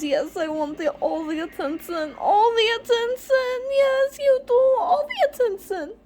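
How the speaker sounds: put-on high squeaky baby voice for the pet cat; cat's voice. Owner voicing her spoiled cat begging for attention, squeaky high pet voice. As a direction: coo her lines like a spoiled kitty, pitch way up and loud. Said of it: "She says".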